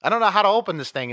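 hammed-up frustrated complaint; mock exasperated. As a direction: play her fed up with the door, hurrying. Voicing Nebula arguing with Star-Lord.

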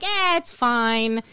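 drawn-out, careless "eh"; mock carefree youngster; dismissive shrug. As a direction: wave it off lazily. Mimics carefree twenty-somethings.

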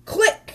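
high sharp click; crisp camera click sound. A narrator's reading. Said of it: "said the camera".

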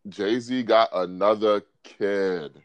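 drawn-out, low restatement; slow emphatic repeat. Real, not acted. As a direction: spell the news out slowly. Repeats the news after disbelieving question.

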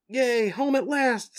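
high, slow, happy cheer; cheerful Leo. Acted. Leo cheers on getting home, high and drawn out. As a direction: stretch out a relieved hooray. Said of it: "says Leo".

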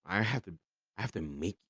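usual speaking voice

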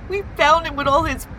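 hiccupping sobs. Acted. Reporting friend's death, upset.

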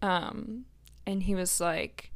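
usual speaking voice

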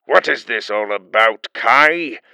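put-on an old official annoyed at the interruption; mild irritated demand; irritable. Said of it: "the Union Chancellor said irritably".

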